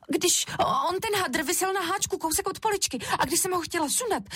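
put-on high child voice, making excuses; pleading little Šárka. A narrator's reading. Šárka explains the fall to mami, pleading.

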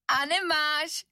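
high, jeering little creature voice; mocking taunt. A narrator's reading. Rusty sofa spring mocks Anička, high and jeering. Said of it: "vysmívalo se zrezivělé péro".

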